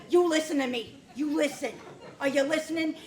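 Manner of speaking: ordinary delivery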